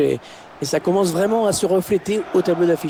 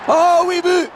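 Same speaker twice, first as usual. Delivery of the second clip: high, loud goal shout; goal call; 4 dB louder, slower than usual. Real, not acted. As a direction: erupt as the ball goes in. Goal scored, commentator erupts.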